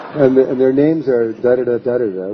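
words bubbling with real laughter; laughing while talking. Real, not acted. Laughing as she tells how the psychic exposed him. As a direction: laugh through the list of names.

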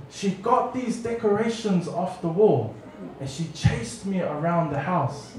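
ordinary delivery

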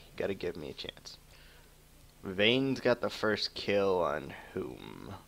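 usual speaking voice

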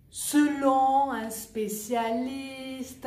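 "Selon un spécialiste" very slow, no n linking "Selon" to "un"; no liaison. Shown as a demonstration. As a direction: stretch it slowly, keep "Selon" and "un" apart. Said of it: "Pas de liaison".

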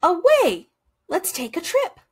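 high, coaxing duck voice; excited duck urging a trip; eager duck. A narrator's reading. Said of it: "Goose honked".

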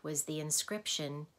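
ordinary delivery

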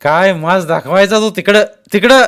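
loud, high, dismissive retort; shouted scorn. A narrator's reading. Masked man tells Jaydeep to take his arrogance away, shouting. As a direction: send him packing at full volume. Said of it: "तोही ओरडून म्हणाला".

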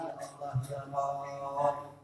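a student's humble, hushed prayer; earnest prayer. Pretend. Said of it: "kalau kalian doa".

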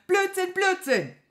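high, loud explosive shout; furious yell. A narrator's reading. Wizard enraged by his servant's muddle, yelling. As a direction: explode at the nonsense. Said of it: "schrie Petrosilius Zwackelmann".

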